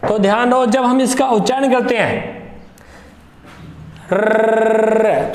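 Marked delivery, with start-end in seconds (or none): 4.1-5.3 रर्र rolled long with a trembling tongue; trilled r demo. Shown as a demonstration. Teacher showing students the trilled r sound. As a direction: let the tongue flutter on रर्र. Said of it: "तो जीवा कांप रही है".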